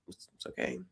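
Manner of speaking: barely audible, voiceless reassurance; imitated hushed soothing; consoling whisper. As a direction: whisper it as if comforting someone in tears. Imitates friend consoling crying man.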